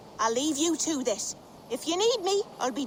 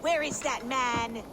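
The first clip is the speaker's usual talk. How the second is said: ordinary talk, nothing marked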